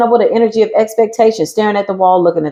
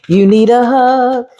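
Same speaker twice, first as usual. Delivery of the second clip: mock sympathy; 4 semitones higher, slower than usual. Taunting the enemy.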